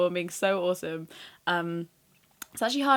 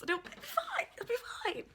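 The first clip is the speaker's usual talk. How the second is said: tiny, very high, soft squeak; panicked self-reassurance; 10 dB quieter than usual. Acted. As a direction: squeak it quietly, trying to convince yourself. Panicking about the Spanish accent.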